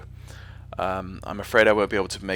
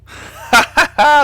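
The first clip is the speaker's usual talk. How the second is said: loud, high burst of laughter; sudden loud cackling laugh; amused laugh; 10 dB louder than usual. Real, not acted. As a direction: burst out laughing at a cheeky comment. Laughs at viewer's accent question.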